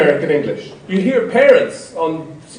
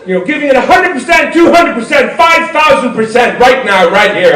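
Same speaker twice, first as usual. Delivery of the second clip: higher, shouted pep-talk energy; hammed-up hype; 5 semitones higher, 4 dB louder than usual. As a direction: pump it up like a motivational coach. Parodying American hype.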